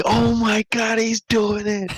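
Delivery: high, excited squeal; excited disbelief. Retold or imitated. Kids watching Jabari, high excited squeal.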